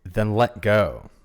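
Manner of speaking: slow, loud command from above; booming voice. Acted. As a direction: boom it down, slowly. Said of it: "a booming voice says".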